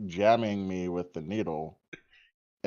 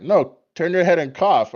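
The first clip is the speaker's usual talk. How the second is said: a hint of a firm nurse, higher and louder; firm nurse correction, lightly done; lightly voiced nurse; 8 semitones higher, 6 dB louder than usual. Nurse correcting the confused patient, lightly voiced firm.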